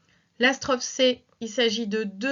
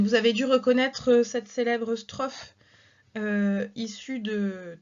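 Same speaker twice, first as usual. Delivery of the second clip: ordinary talk, nothing marked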